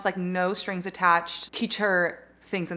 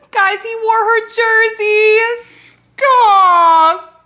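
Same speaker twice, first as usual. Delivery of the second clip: high, loud, drawn-out wail of delight; shrieking, swooning book excitement; fangirl squeal; 13 semitones higher, 13 dB louder, much slower than usual. Real, not acted. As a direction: squeal slowly, completely overwhelmed by romance. Squealing over a romantic detail.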